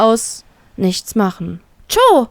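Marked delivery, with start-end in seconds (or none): 1.9-2.3 alarmierter Ruf. Acted. Friend collapses in cell.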